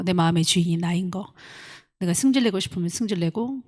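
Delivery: soft, earnest prayer voice; hushed, earnest prayer. Acted. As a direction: pray quietly before the congregation. Leads closing prayer.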